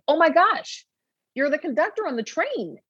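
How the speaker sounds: high-pitched, excited retold exclamation; delighted recognition. Doctor recognizing her dream patient in the office, retold with delight. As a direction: relive the happy surprise, pitch jumping up. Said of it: "face lights up and I'm like".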